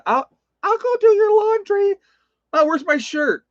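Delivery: squeaky imitation of mom fussing over laundry; mock mom voice. Son imitates mom doing his laundry, high mock voice.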